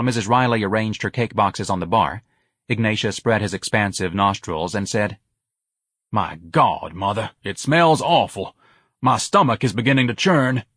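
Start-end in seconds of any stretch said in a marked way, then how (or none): 6.1-10.6 slow, dramatic complaint; disgusted pompous son. Acted. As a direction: lament grandly to mother.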